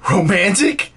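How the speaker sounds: loud, high, rising echo of disbelief; acted indignant disbelief; incredulous. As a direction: throw the word back as an offended question. Acted disbelief at girlfriend's complaint.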